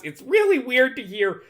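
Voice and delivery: a lingering high nerdy voice. Retold or imitated. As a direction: keep the nerd voice going. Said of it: "I gotta stop doing that voice".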